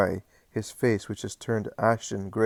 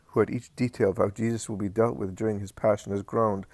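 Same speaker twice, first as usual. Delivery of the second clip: ordinary delivery, nothing marked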